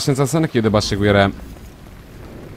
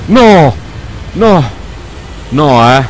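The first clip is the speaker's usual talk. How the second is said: loud, high cries of no; alarmed shouts; 4 semitones higher, 5 dB louder, much slower than usual. Real, not acted. Monster appears, cries no.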